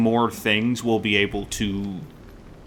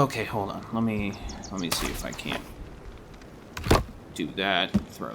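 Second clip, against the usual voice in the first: much softer, half to oneself; absorbed muttering; 3 semitones lower, 10 dB quieter than usual. Real, not acted. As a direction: mutter quietly while working something out. Concentrating on a fiddly climb.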